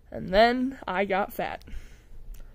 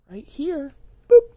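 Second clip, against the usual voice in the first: ordinary talk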